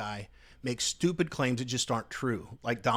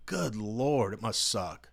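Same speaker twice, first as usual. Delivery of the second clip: ordinary delivery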